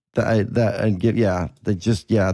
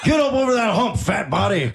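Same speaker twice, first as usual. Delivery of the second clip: raised pitch mock drill yell; drill sergeant; 8 semitones higher than usual. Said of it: "He called that guy fat body".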